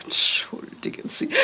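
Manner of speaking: embarrassed whisper, voice dropped; a hushed excuse for rambling; whispered apology. Acted. Apologises for chattering on. (geflüsterte Entschuldigung)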